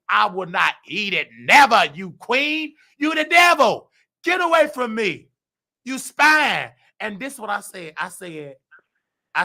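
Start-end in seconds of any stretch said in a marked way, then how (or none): 0.1-6.7 furious refusal. Retold or imitated. Refusing the manager's offered food, shouting him down as the devil.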